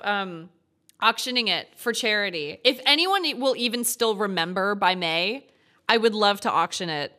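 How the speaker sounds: ordinary delivery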